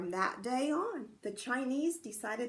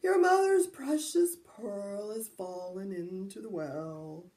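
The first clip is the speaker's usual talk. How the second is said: put-on slow dreamy old voice; lightly voiced sleepy old man; drowsy old man; 3 semitones lower, 3 dB louder, slower than usual.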